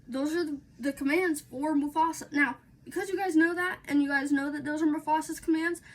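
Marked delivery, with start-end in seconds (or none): none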